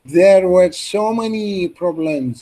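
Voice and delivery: slow, careful classroom demo; slow model reading. Shown as a demonstration. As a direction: spell it out slowly like a teacher modelling. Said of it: "copy the stress and intonation".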